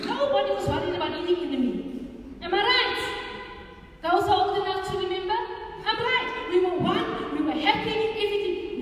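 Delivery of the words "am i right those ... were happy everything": rousing preaching. Real, not acted. Rousing the congregation.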